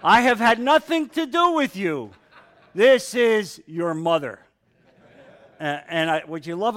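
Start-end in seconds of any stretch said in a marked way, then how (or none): 0.0-4.4 loud, laughing mock rage, pitched up; mock furious king. Preacher hams up Saul's fury for the men, laughing mock rage. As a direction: ham up Saul's fury while chuckling. Jokey paraphrase of raging Saul.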